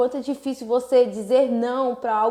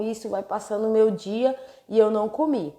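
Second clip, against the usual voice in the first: ordinary talk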